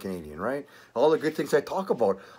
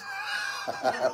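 high, shouted burst of laughter; shrieking laugh; 8 dB quieter than usual. Real, not acted. Laughing at own jail joke.

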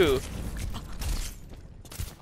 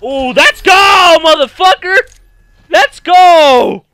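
very loud, high, drawn-out cheer; triumphant shouting; 7 semitones higher, 12 dB louder, slower than usual. Real, not acted. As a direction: roar it out after a big kill. Celebrating a kill.

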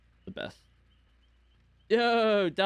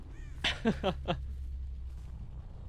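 breathy laugh; 6 semitones higher, 7 dB quieter than usual. Real, not acted. Laughing at jump scare.

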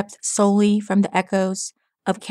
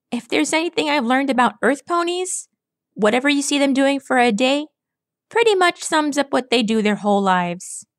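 brash tomboy pegasus, pitched higher and quick; cocky young pegasus needling a gloomy stallion; Rainbow Dash; 5 semitones higher, faster than usual. Acted. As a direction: tease him in a cocky, fast, higher voice. Rainbow Dash voiced by narrator.

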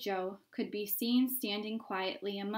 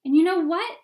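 high, very loud shout; shouting back; 8 semitones higher, 13 dB louder than usual. A narrator's reading. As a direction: yell it back across the crowd. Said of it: "somebody else shouted back".

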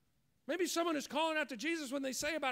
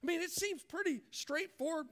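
normal talking voice

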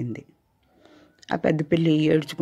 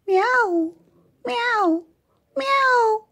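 much higher, long falling meows; cat meowing. Acted. Grandmother as the mother cat teaching the kitten, high meowing. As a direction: meow like a mother cat showing the way. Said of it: "నేను ఎలా అంటానో చూడు".

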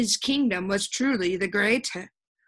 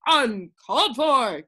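loud, slow, higher exclamation; crying out; 4 semitones higher, 6 dB louder, slower than usual. A narrator's reading. Undersecretary capping the advisors' refusals, crying out emphatically. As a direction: cry it out, stretching the words.

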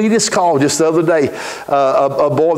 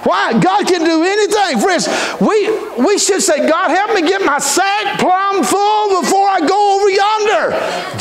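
preaching shout. Acted. Preacher urging friends to pray, chanting shout. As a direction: ring it out to the congregation.

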